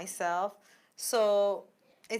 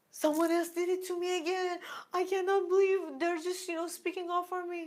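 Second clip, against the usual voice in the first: sharp gasp, then higher self-pitying voice; gasping victim; 8 semitones higher than usual. Retold or imitated. Retelling her old victim reaction, gasping and higher-pitched. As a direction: play the wounded victim with a gasp. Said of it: "I caught myself".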